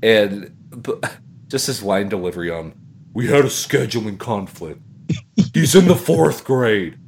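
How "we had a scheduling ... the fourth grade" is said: deadpan quote. Retold or imitated. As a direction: deliver it with mock gravity. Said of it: "it was delivered perfectly".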